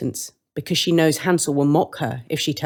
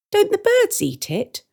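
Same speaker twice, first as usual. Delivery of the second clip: put-on high, slow curious girl; Gretel's voice. Gretel questioning the woman, high and slow. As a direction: ask it wide-eyed and slowly. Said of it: "Gretel asks".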